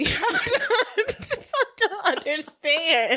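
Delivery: slow words breaking into laughter; real disbelieving laugh. Real, not acted. Laughing at the topic.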